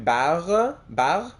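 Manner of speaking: Barre said the French way with a throaty r and no final vowel. Shown as a demonstration. Answering a viewer, showing the French way. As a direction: give Barre a French r and stop on the consonant. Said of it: "You're the best at pronouncing French last names".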